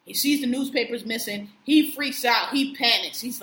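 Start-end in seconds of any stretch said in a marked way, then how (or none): none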